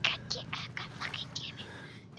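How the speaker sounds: hissed under the breath; muttered real frustration; whispered curse. Real, not acted. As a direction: swear in a whisper, slowly. Whispered curse after loud one.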